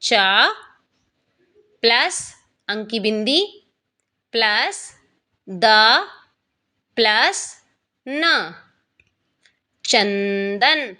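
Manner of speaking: चा, अंक की बिंदी, द, न spaced out slowly, then चंदन drawn out with its nasal; slow teacher breakdown of चंदन; sounding out parts. Shown as a demonstration. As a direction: build चंदन syllable by syllable. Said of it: "एक example और ले लेते हैं".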